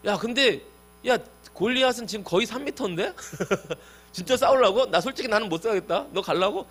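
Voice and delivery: high-pitched comic disbelief with a laugh; mock-amazed questioning, amused; incredulous. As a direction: play a king astonished and chickening out. Saul doubts, admits he would not fight.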